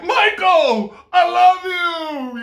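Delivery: high, gushing squeal of love; shrieking fan meeting Michael; starstruck fan. Pretend. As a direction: gush at the star like a fan. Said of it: "you'll be like".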